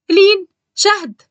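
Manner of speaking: loud, high, drawn-out call across the house. Acted. Aunt summoning the girls from downstairs, loud drawn-out call. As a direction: stretch the names out as you call upstairs.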